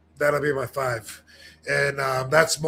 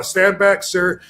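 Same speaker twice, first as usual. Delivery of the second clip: imitating a cop; 4 semitones higher, 6 dB louder, slower than usual. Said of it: "they'll say to him".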